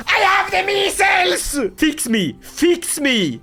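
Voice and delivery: high, slow hammed-up wail; mock sick shout. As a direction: wail it like a dramatic patient. Ruler voicing sick character.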